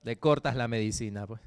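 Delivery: usual speaking voice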